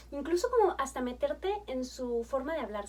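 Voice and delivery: ordinary delivery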